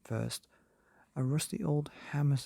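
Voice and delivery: usual speaking voice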